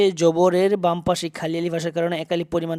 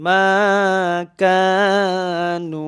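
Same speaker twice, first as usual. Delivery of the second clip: drawn-out recitation; 2 semitones higher, 5 dB louder, much slower than usual. Shown as a demonstration. Teacher models madd for students, slow chanted recitation. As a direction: stretch the vowel of Kanu one alif long.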